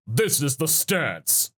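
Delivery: slow, drawn-out mock battle line; hammed-up, stretched declaration; game quote imitation. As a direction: imitate a fighting-game voice clip. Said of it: "it's just that hit going".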